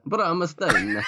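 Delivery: high Italian-accented Bramstein with a laugh. Shown as a demonstration. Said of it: "in an Italian accent".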